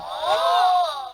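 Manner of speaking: sharp excited gasp; high surprised gasp. Acted. Children gasping at the videotape, high gasp. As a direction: gasp in delight.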